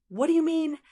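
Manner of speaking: high-pitched puzzled question; doubtful. A narrator's reading. Blackbird unsure of the question about its age, doubtful. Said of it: "asked doubtfully".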